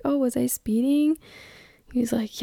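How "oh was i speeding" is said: put-on higher-pitched innocent young mom act; retold wide-eyed innocent question; playing innocent. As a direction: feign clueless innocence, pitched up. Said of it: "play it as the, like, innocent, young, innocent mom".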